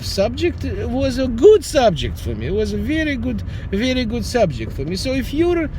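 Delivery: ordinary delivery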